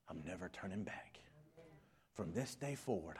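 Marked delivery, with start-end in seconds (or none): 0.1-1.2 soft whispered vow; hushed determined whisper. Acted. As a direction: whisper your resolve. Quiet vow.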